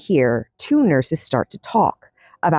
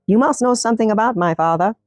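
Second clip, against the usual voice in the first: usual speaking voice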